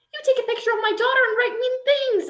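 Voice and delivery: mother rebukes the girl who mocked her daughter; angry scolding. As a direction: tear into her for what she did. Mom confronts daughter's bully.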